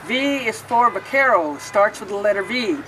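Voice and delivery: put-on lilting rhyme read aloud to kids; reading to children. A narrator's reading. Librarian reading a picture book to children, lilting. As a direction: read each verse like storytime.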